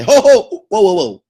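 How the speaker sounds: sudden high, loud whoas; alarmed halt. Acted. Analytical mind breaking in to stop things, loud alarmed halt. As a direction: call a loud, urgent halt.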